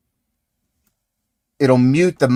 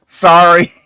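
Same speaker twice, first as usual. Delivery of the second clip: laughing apology; 7 semitones higher, 7 dB louder than usual. Real, not acted. Laughs after sound mishap.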